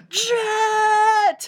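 high, loud mock announcement; dramatic reveal. Telling friends who shows up, a high loud reveal. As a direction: unveil the villain's name with a flourish.

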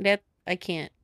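usual speaking voice

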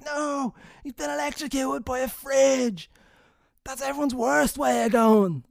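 high, slow, alarmed imitation of a coworker; panicky coworker. Coworker panicking, thinks he's electrocuted.

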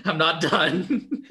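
slow, through laughter; laughing. Real, not acted. Host warns the story gets worse, laughing. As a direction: drag it out while laughing. Laughing, warning more comes.

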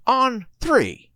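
very high, drawn-out voice; high slow insistence. Acted. Tallahassee repeats the countdown to Little Rock, very high and slow. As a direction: stretch the words out high and exasperated.

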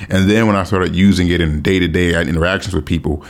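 ordinary talk, nothing marked

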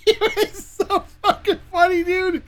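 real helpless laughter; laughing hard. Real, not acted. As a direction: lose it laughing. Cracking up at story.

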